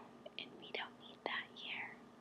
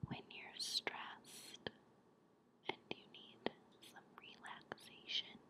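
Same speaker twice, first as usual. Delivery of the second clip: close, hushed guided-breathing whisper; whispered relaxation; 14 dB quieter than usual. Real, not acted. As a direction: whisper calm reassurance with long pauses.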